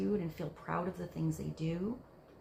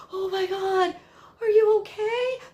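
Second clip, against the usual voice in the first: much higher, loud alarmed gasp of worry; panicky fussing mother; 12 semitones higher, 10 dB louder than usual. Retold or imitated. Mother to a child who fell, panicked and loud. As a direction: overreact like a smothering parent. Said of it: "a little bit obsessive energy, mothering energy".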